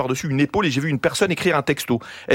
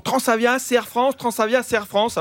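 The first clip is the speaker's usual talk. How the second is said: lightly mocked higher-pitched chant; people insisting; 5 semitones higher than usual. Comic quoting what everyone tells him, higher mock insistence. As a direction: mimic people repeating a reassurance. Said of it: "on me dit toujours".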